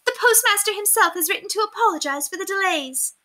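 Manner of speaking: high put-on aristocrat voice; excited lady voice. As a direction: play the vain lady, pleased. Lady Constance explains delay.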